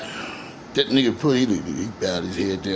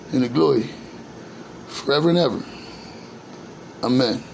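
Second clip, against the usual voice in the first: prayer; 4 semitones higher than usual. Acted. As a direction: pray it plainly to God, humbly.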